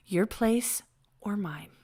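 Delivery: slow breathy whisper; whispered sexy voice. Acted. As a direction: whisper it seductively. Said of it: "The sex operator voice again".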